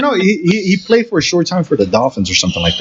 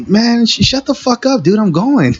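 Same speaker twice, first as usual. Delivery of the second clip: much higher, snapping back at the boss; retold angry retort; 10 semitones higher than usual. Worker snapping back at screaming boss, high mock retort. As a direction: fire it back like a fed-up loader.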